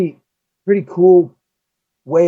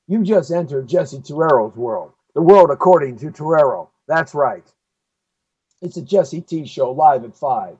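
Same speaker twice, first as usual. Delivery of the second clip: ordinary delivery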